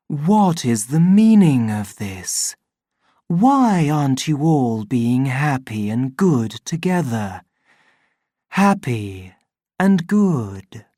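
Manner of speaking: slow, sorrowful public-address voice; a tender machine voice pleading with rioters; mild hammed-up reproachful sorrow; gentle sorrow. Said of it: "with a note of such gentle sorrow".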